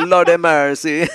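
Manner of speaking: put-on loud Jamaican rude boy exclamation. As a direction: cry out in mock patois. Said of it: "rude boy voice".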